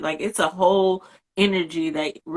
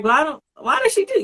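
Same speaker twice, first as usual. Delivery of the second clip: mock annoyance; 10 semitones higher, faster than usual. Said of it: "You used to be like".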